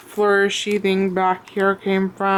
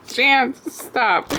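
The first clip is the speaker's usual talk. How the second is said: scolding a pet; 5 semitones higher than usual. Real, not acted.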